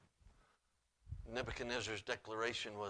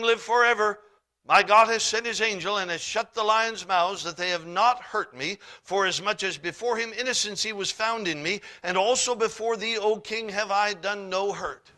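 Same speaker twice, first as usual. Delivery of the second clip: loud, high, ringing scripture reading; preaching scripture; 9 semitones higher, 12 dB louder than usual. Acted. Preacher booming the Bible story to the church. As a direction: proclaim the king's words to the congregation.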